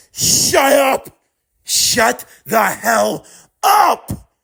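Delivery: high, slow, furious yell; enraged shout. Acted. Miles yelling at taunting Mahito in rage. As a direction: scream him into silence.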